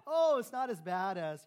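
loud, slow mock dismissal; mock brush-off. Shown as a demonstration. Pastor acting a careless listener, loud and slow. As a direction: lean on it, shrugging off the hurt. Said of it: "you know, whatever".

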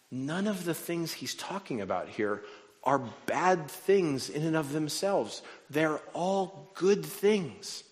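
high-pitched, stretched-out preaching delivery; emphatic preaching. Real, not acted. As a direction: drive the point home to the congregation. Preaches the point after the story.

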